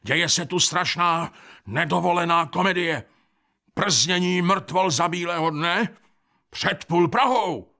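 high, insistent outrage; high-voiced scandalised report, stretched at the end; indignant complaint. A narrator's reading. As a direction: protest to an official, pitch held up. Complaint called to the police commissioner.

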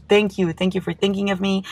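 usual speaking voice

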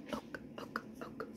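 normal talking voice

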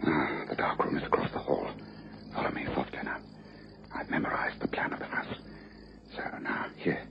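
hushed whisper while creeping through the house. Acted. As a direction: whisper so nobody in the house wakes. Sneaking into the house at night.

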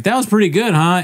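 lightly hammed-up fishing for agreement; mock upbeat. Optimist asking girlfriend about the meal, mock upbeat.

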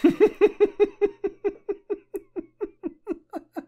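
squealing high laugh; high shrill laugh. Real, not acted. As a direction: shriek with laughter. Schrilles Lachen. (schrilles hohes Lachen)

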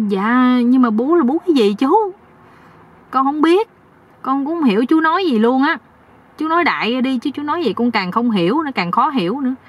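high-pitched, baffled naive voice; confused young husband. Acted. (giọng chàng trai cao, ngơ ngác)

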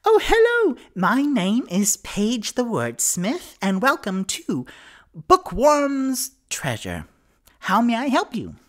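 very high, loud dragonborn bookseller; effusive shopkeeper. Acted. Bookseller welcomes newcomers to her shop, effusive high voice. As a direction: greet customers in a big, high-pitched gush. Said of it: "who walks up and goes".

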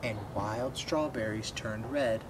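ordinary delivery, nothing marked

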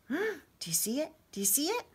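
soft, high, awed question; whisper-soft wonder for young listeners; hushed amazement. Acted. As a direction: breathlessly point out a marvel. Awed at the coloured leaves.

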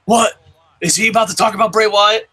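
high-pitched incredulous imitation; Wrestling Ranting. Host imagining a fan waking up to Bray Wyatt talk, incredulous. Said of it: "And he's like".

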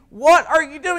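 loud, high-pitched mock yell; imitated yelling. Preacher acting Pharisees yelling at Jesus, imitated yelling. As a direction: raise pitch and volume in imitated outrage. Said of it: "their yelling at him".

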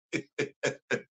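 short, soft trailing laugh; soft chuckle. Real, not acted. Host amused at reading in Wakandan accent, soft chuckle. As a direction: chuckle quietly to yourself.

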